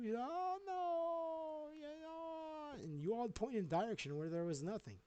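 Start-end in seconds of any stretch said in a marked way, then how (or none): 0.2-2.8 high-pitched fake wail; hammed-up sobbing lament, pitched way up; mock crying. The other one comes and joins in, mock crying. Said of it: "and he's crying too".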